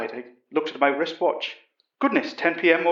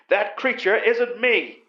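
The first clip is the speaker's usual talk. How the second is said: slow, higher, upset denial; 6 semitones higher, slower than usual. A narrator's reading. Major denying he is the creature, slow upset protest. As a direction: insist every word slowly. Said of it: "Utterson cried".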